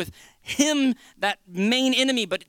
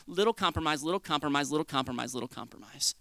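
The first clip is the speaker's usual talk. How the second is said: each repeat lower and softer; fading repetition; 6 semitones lower, 6 dB quieter than usual. Shown as a demonstration. Enemy's little compromises creeping in, steadily fading. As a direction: step down in pitch and volume to near murmur.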